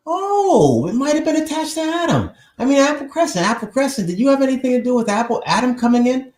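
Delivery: high, loud, fast excited calling out; excited greeting. Real, not acted. Calling out to a viewer about Adam, excited and high. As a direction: call out to the viewers with glee.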